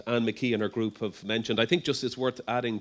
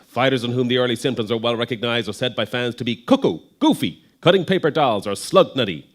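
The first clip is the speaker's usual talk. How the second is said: put-on loud, punchy old-time radio announcer; 1928 radio voice; 3 semitones higher, 11 dB louder than usual. Lecturer reading an old boxing paper aloud to the audience, 1928 radio voice. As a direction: boom it out like a 1920s newsreel, key words punched. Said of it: "use my nineteen twenty-eight radio voice".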